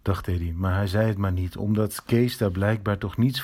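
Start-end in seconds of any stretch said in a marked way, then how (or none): none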